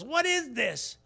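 high, loud outraged cry; a boy appalled at what he hears, voice up high; young David can't believe nobody acts; indignant shepherd boy. Retold or imitated. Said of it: "and he goes".

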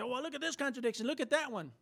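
mock the online skeptic quickly. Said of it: "Somebody's gonna go Google and say".

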